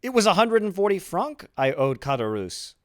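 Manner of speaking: high, loud exclamation; crying out. A narrator's reading. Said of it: "cried Dantes".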